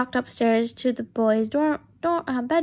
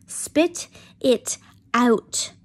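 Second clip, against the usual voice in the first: slow, drawn-out Irish-sounding Spit it out; unplanned Irish lilt; accidental Irish accent; 5 dB louder, much slower than usual. Acted. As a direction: slips into an Irish accent without meaning to. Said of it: "Did I go Canadian out there".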